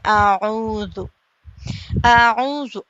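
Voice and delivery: आऊज़ु with the वाव vowel held one alif long; long vowel demo. Shown as a demonstration. Teacher demonstrating a stretched vowel, drawn out. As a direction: draw out the ऊ in आऊज़ु. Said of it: "एक अलिफ के बराबर खींचकर हम पढ़ेंगे".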